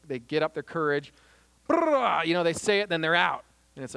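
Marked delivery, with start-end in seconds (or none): 1.7-2.2 a loud, higher burst of revving noise; mock revving-up noise. Peace faker psyching up to speak, loud revving burst. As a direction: rev yourself up with a loud brrrah. Mustering courage before confronting.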